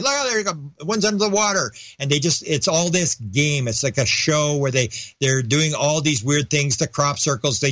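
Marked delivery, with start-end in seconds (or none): 0.0-1.7 much higher, gleeful alien pointing out the jet; pitched-up alien cheering the chase; mock alien glee. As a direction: tease like a delighted alien. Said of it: "And the F eighteen goes flying".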